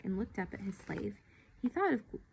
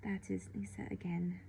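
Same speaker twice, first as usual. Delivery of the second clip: soft unvoiced whisper; girl whispering to the boy so the nurse won't hear; 5 dB quieter than usual. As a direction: whisper it, barely a breath. Girl hushes, nurse calling nearby.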